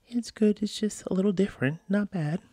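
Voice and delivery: put-on high, hesitant kid voice; mock kid voice. Dad imitating his son saying the turkey is fine, high kid voice. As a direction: voice the boy being polite but unsure.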